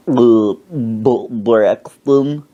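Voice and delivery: put-on stammering, labored old man; stuck on the first sound; old man's halting talk. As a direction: imitate an old man struggling to get the word out. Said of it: "That's how he talked".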